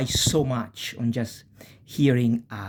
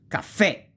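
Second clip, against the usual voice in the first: caffè, final è raised high; stress on last syllable; 8 semitones higher, 4 dB louder than usual. Shown as a demonstration. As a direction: land hard on the è that ends caffè. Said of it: "you pronounce it".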